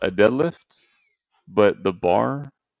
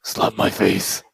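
mock Batman voice; 3 semitones lower, slower than usual. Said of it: "Sound like Batman".

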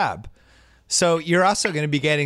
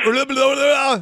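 much higher, loud mock gibberish; shouted translator babble; 14 semitones higher, 5 dB louder than usual. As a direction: yell the nonsense like a frantic interpreter. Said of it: "reenact the end of Rocky four".

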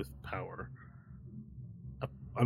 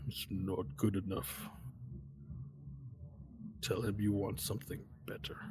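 hooded figure; 11 dB quieter than usual. Acted. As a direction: murmur it softly from behind, like a hooded stranger. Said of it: "The hooded voice appears from behind".

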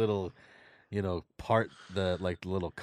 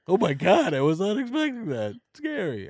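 mock startled; 8 semitones higher, 4 dB louder than usual. Acts out startled reaction at door.

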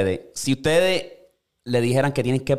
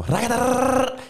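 mock revving noise; 13 semitones higher, much slower than usual. Imitating speeding up.

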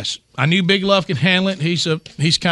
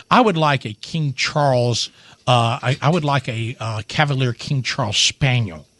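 put-on proper little boy; 5 semitones lower than usual. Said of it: "you know how he talks so proper".

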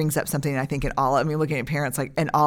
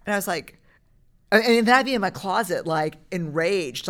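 ordinary delivery

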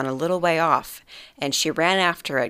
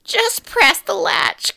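put-on very high, slow old woman's call; old grandmother calling; much slower than usual. Grandmother calling to the wolf at the door, high and slow. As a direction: call feebly from the bed. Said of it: "called out the grandmother".

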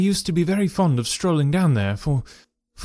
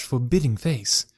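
ordinary delivery

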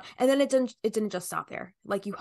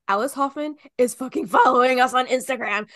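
louder, breathless announcement; excited news; 3 semitones higher, 8 dB louder than usual. Retold or imitated. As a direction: blurt out the big news. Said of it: "I was like".

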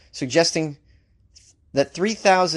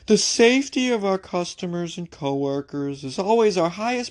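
higher-pitched droning official voice; boring spokesperson; 5 semitones higher than usual. Acted. Another ikea spokesperson giving a comment, boring drone. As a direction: recite it as a dull company statement. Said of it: "Another ikea spokesperson said this boring comment".

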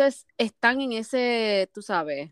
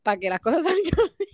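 giggling through the words; laughing; 6 semitones higher than usual. Real, not acted. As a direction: laugh while saying it. Laughing at own reaction.

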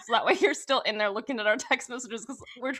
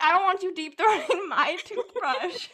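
laughing; 5 semitones higher, 4 dB louder than usual. Retold or imitated. Quoting herself scolding roommate, laughing.

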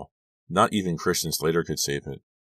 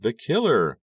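ordinary delivery

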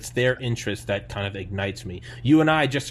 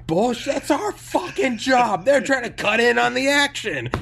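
sarcastic yell; 13 semitones higher, 6 dB louder than usual. Retold or imitated. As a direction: shout the punchline, laughing.

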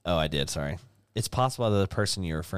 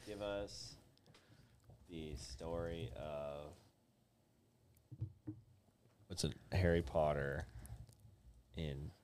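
very slow and soft, phrase by phrase; long gaps between chunks, quietly dictating; slow dictation; 16 dB quieter, much slower than usual. Real, not acted. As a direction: murmur it slowly as you type. Dictating the prompt while typing it.

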